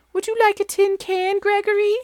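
very high, sing-song mother goat offering. Acted. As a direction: sweetly coax like a doting mom. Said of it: "asked Mother Goat".

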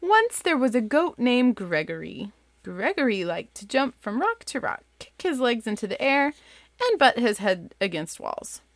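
lively bedtime-story read-aloud; reading to kids. Acted. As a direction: read it like you're sitting with your kids at bedtime. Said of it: "the same voice that I do for my kids".

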